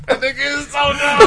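laughing shout. Real, not acted. Laughing at bad game answer.

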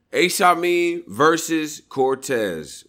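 high, slow hype announcement; announcer call. Real, not acted. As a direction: stretch the names like a ring announcer. Host announcing the matchup.

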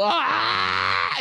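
high mock battle scream; acted scream. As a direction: scream as if going over the top. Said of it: "And you just screamed".

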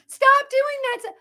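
high, loud, drawn-out demand; mock protest. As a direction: imitate a parent shouting at the school board. Acted outcry at school board.

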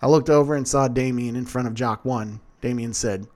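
ordinary delivery

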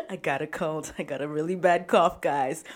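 loud protest. Retold or imitated. Her loud retort to friends.

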